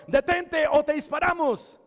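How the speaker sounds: high, loud retold threat; shouted threat. Armed strangers threaten the fleeing boy, shouted warning. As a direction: act out a gunman's shouted warning.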